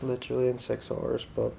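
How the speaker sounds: hushed late-night whisper. Real, not acted. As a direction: whisper so you don't wake anyone. Late night, camera dying, others sleeping.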